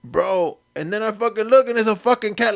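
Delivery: ordinary talk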